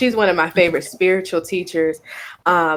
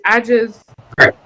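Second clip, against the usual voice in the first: ordinary talk, nothing marked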